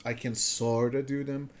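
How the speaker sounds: ordinary talk, nothing marked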